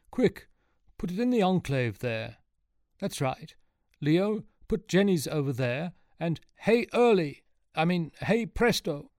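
high, urgent character voice; excited, eager helper. A narrator's reading. Luca directing the others to place the eggs, high and excited. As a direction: give rapid-fire orders in a high excited voice.

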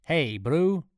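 high, drawn-out call; calling across a gap. A narrator's reading. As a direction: call out to someone far off. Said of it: "called across the drawbridge".

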